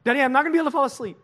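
high, loud, fast tearful wail; crying child. Retold or imitated. Son Jet crying to dad at night, high and fast. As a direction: imitate a sobbing boy blurting it out. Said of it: "And he was crying".

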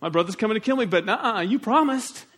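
high, loud and frantic, begging God; desperate pleading prayer. Acted. Jacob begging God to keep his promise, frantic and loud. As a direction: cry out to God as if your brother is coming to kill you. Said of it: "So he's saying".

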